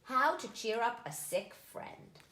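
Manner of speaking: usual speaking voice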